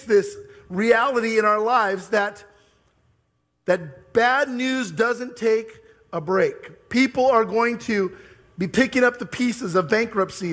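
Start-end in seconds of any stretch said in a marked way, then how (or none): 3.7-6.9 very slow, every word weighted; slow emphatic point. Real, not acted. Pastor driving home his point to the congregation, slow. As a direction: land the refrain deliberately. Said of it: "sermon emphasis".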